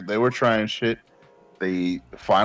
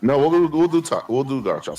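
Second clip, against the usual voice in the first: ordinary delivery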